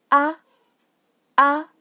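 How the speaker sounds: short, light "ah"; neutral fifth tone. Shown as a demonstration. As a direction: cut "ah" short, with no glide. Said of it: "that's the last tone".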